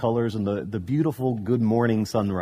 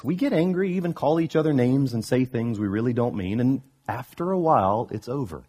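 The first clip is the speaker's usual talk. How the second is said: ordinary delivery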